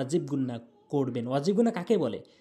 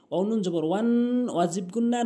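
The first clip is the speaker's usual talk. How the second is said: usual speaking voice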